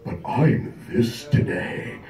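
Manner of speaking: soft low dopey mumble; imitating the customer. Customer stares at the vendor, mumbling low and soft. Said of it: "He just looks at me and goes".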